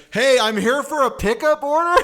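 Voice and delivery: loud, high mock yell into the back; retold yell. Customer yelling into the back for a pickup order, mock yell. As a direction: holler it out, half laughing. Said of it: "yell into the back and be like".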